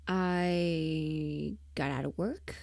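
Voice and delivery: ordinary talk, nothing marked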